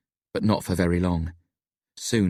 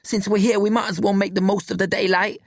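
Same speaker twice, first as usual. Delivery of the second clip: high-pitched pleading voice; 13 semitones higher than usual. A narrator's reading. Billy urges his friends to use the daylight, pleading. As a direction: press the plea, high and insistent. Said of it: "Billy pleads".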